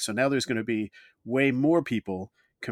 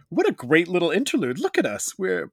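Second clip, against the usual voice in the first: gushing excitement; 6 semitones higher, 3 dB louder than usual. Real, not acted. Gushing about their own show.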